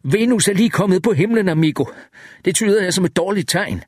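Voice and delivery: high-pitched, desperate call; undisguised desperation. A narrator's reading. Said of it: "stemmen var fyldt med utilsløret desperation".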